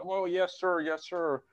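lightly ape a brown-noser bowing to the boss; mock servile yes-man. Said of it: "they're like".

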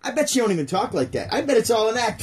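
ordinary talk

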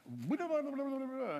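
high mock chatter of the daughter; high, dismissive imitation of a talker; imitating babble. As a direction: rattle off blahs in a high voice. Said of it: "she's like".

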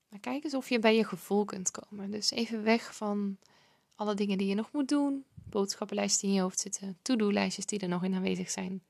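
calm breathing guide, hushed and unhurried. Acted. Leads a breathing exercise.

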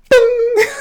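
high, loud burst of laughter. Real, not acted. Laughing out loud at the embarrassing bing. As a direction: crack up at the memory. Phone went off mid-pause.

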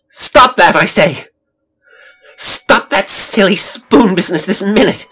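high, very loud furious command; full-force exasperated yell; angry outburst. A narrator's reading. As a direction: shout at a child at the end of your patience. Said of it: "had seen Elizabeth's outburst".

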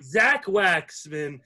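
roll-call of guests, announcer style; projected, ceremonial show welcome; podcast host announcement. Acted. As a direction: introduce each guest like an emcee.

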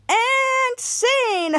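high, drawn-out announcement; triumphant sign-off. Real, not acted. Host ending the retelling, high drawn-out flourish. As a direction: call the curtain with a flourish.

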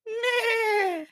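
neigh like a frightened horse; horse whinny. Acted. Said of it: "A horse's whining".

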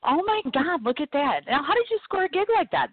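amazed. Real, not acted. Host amazed by Disney job.

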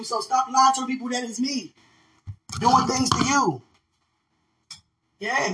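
high, loud, laughing callout to a liar; sassy callout. Retold or imitated. Calling out people who spread lies about her, high and loud. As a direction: call them out with a laugh. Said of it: "I'm like".

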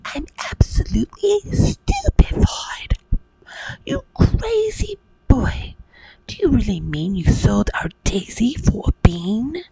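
high, squealing outraged mum; shrill shocked mother. Acted. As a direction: screech it in disbelief.

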